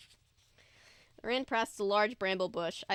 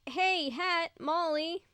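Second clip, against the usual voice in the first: higher call to the friends; 7 semitones higher, slower than usual. A narrator's reading. Calling friends in woods.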